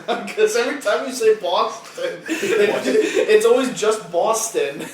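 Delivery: high, loud laughing. Real, not acted. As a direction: crack up mid-sentence. Laughing at his Boston.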